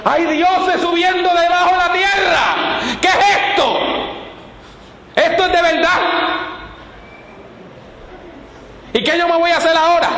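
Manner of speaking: high, panicked scream of a frightened medium; terrified screaming. Acted. The medium at Endor sees spirits rising, screaming in terror. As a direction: shriek it as if spirits are rising before you. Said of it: "la divina empieza a gritar".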